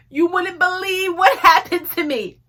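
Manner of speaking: high, slow mock-tearful whine to mom; drawn-out plaintive wail, half laughing; retold tearful complaint. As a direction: imitate a weepy call to mother, hammed-up. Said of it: "I was crying".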